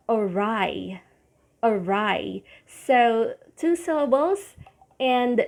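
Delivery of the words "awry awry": awry, uh-RYE, stress on RYE; model word. Shown as a demonstration. Teacher modelling awry as uh-RYE. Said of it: "Next is".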